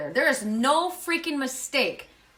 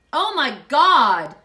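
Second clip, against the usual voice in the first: stunned; 7 dB louder, much slower than usual. Real, not acted. Card vanished from the deck.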